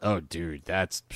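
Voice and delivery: ordinary delivery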